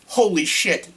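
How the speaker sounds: usual speaking voice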